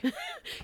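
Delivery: high delighted laugh; burst of laughter. Real, not acted. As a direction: break out laughing. Laughs at the read line.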